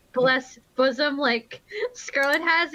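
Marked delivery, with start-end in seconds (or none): none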